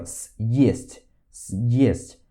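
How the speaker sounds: "съесть" with a hard separated s; emphatic съесть. Shown as a demonstration. Teacher demonstrates "съесть" with emphasis. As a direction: stress the break in "съесть". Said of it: "a gente faz assim".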